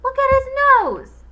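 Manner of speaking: put-on loud, high kid's shout. A narrator's reading. Kid shouting at the teacher's nose, loud and high. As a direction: yell it out at the class. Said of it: "shouted Eric Bacon".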